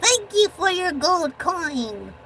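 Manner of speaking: high, lightly voiced little character; a small creature saying thanks; little guy voice. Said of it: "this little guy can do that better".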